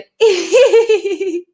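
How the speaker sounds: high, loud "hee-hee" giggle; a put-on shy titter, pitched high; shy laughter. Shown as a demonstration. Coach leading the host in an exercise, shy laughter. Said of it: "so shy laughter".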